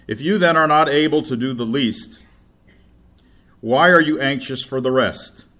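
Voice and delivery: loud, declaiming Bible reading; scripture reading. Acted. Preacher reading Luke to congregation, declaiming loudly. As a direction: proclaim the passage to the church. Reads scripture at full force.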